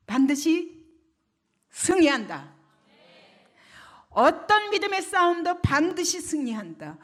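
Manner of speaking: loud, high rallying proclamation; impassioned preaching. Real, not acted. Preacher urges congregation to trust in victory, fervent and loud. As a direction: rouse the congregation to certain victory.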